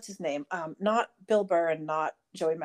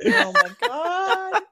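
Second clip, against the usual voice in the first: laughing disbelief; 13 semitones higher, 6 dB louder, much slower than usual. Real, not acted. Stunned reaction, laughing.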